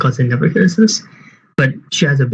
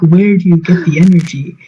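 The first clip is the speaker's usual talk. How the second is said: higher-pitched mock despair at a baby; hammed-up exasperated begging; retold exasperated yelling; 5 semitones higher, slower than usual. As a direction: imitate your own fed-up pleading, pitch raised. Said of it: "I yelled at the baby".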